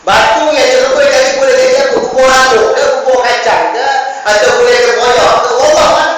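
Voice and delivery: loud, high, ringing preacher's voice; full-force sermon delivery; forceful preaching. Acted. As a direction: proclaim the verse to the whole hall. Preacher's mocking rhetorical questions.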